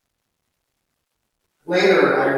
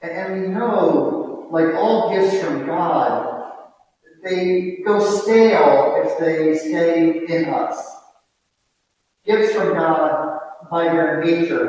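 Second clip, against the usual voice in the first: preaching; 3 semitones higher, 5 dB louder than usual. Real, not acted.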